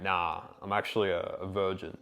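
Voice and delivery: mock-innocent sarcastic denial. Shown as a demonstration. Coach answers the fuckboy test, deadpan sarcasm. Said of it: "sarcastically not agree".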